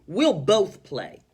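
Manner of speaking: very slow and loud, firm; confident. Shown as a demonstration. Teacher voicing confident Missus Teaberry closing the call. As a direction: declare it decisively. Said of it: "She's confident".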